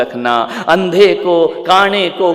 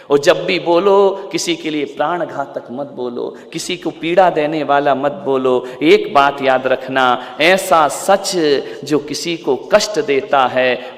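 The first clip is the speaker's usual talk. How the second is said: usual speaking voice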